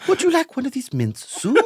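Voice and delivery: very high mock-English accent; Englishy accent. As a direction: repeat it as a squeaky English-accented impression. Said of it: "kind of Englishy accents".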